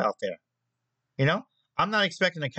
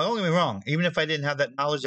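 ordinary delivery

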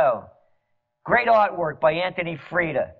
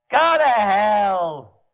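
slow contemptuous dismissal; 4 dB louder, much slower than usual. Real, not acted. Ranting against politicians' control.

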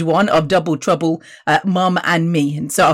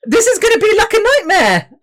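giggly exasperation; laughing; 13 semitones higher than usual. Real, not acted. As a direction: laugh while scolding.

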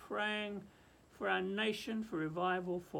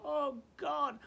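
a higher, louder plea to God; anguished cry; 8 semitones higher, 5 dB louder, slower than usual. Pretend. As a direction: cry out to heaven in anguish, pitched up. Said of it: "I hope you and I are crying out".

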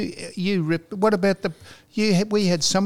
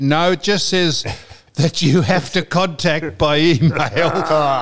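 very slow, congested clerk imitation; mock a droning operator, word by word; Byron; 4 dB louder, slower than usual. Clerk tells caller to contact by email, laboured mock officialese. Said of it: "Wait for this".

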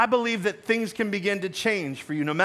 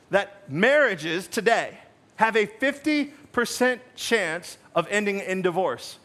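ordinary talk

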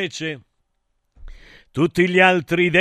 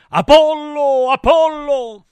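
high calling voice, stretched vowels; crying out to a god; 9 semitones higher, 5 dB louder than usual. Acted. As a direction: cry the name out at length, pitched high. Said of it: "gridai all'infinito".

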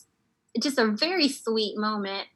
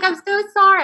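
higher and louder pleading apology; apologetic; 9 semitones higher, 8 dB louder than usual. Retold or imitated. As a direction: beg forgiveness in a rush. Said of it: "I was so apologetic".

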